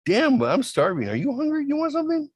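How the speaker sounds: put-on high, silly drunk-guy voice; goofy high offer. Offering the cop food, high and goofy. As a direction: play the clueless drunk, pitch way up.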